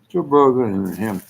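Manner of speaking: usual speaking voice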